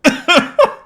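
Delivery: sudden hacking cough; real choking cough; coughing fit. Real, not acted. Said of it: "I'm choking".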